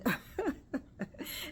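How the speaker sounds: an airy, half-silent laugh; breathy laugh. Real, not acted. Laughing at herself over saggy skin, airy chuckle.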